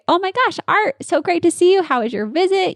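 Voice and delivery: high, bubbly welcome for a patient; over-the-top delighted receptionist; gushing greeting. Shown as a demonstration. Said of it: "So I'd say".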